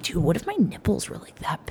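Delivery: soft, dropping into a whisper; hushed inner thought. Retold or imitated. Woman examining herself in the shower, hushed wondering. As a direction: murmur it as a retold private worry.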